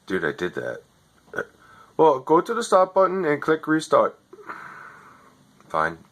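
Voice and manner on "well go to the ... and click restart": higher, scripted helpdesk patter; overseas tech support voice. Retold or imitated. Overseas agent reading his script to a customer, lightly mocking. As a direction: read the script like a bored call-centre agent. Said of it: "You're not even in America".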